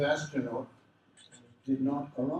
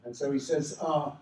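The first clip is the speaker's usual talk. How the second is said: ordinary delivery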